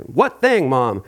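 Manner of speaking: ordinary delivery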